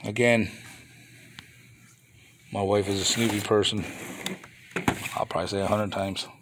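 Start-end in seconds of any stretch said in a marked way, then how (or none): none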